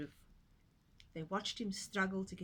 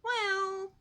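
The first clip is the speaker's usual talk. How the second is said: cheerful piglet; 12 semitones higher, 7 dB louder than usual. Acted. Pig's cheerful exclamation.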